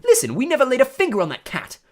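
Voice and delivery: loud, high, heated denial; hotly. A narrator's reading. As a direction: insist on innocence with heat. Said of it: "said Ron hotly".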